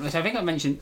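ordinary talk

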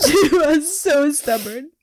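high, slow laugh-crying. Real, not acted. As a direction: laugh and cry it out slowly. Laughing while tearing up.

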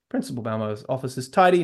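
ordinary delivery

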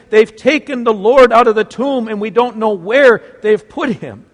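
frantic breathless cry; breathless exclaim. Acted. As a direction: blurt it out in a panic. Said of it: "she breathlessly exclaims".

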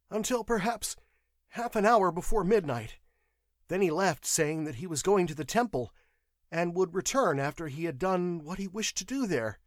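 high, anxious clerk's voice; frightened witness under questioning; nervous clerk. A narrator's reading. Clerk's voice in narrated story.

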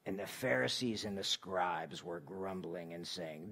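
low, hushed, solemn scripture voice; dramatic scripture reading. Shown as a demonstration. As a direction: read the scripture in a deep, softened storybook voice. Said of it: "I'm gonna read it in the way that I like to listen to it".

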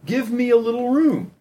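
high-pitched plea to drivers; assertive appeal. Acted. Cyclist messaging car drivers, assertive appeal. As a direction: ask firmly, voice lifted high.

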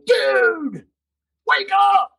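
high, exaggerated preachy plea to wake up; mock-pleading, pitched high. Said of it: "and say".

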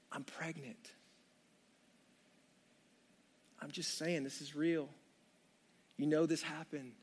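Mary nervously confessing to Joey, very soft; hushed confession. Acted. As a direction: break the news gently under your breath.

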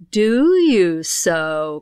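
drawn-out, very slow; the pixie thief, slow and menacing; pixie's slow cry. Acted. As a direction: stretch each word like a threat. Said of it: "cried he".

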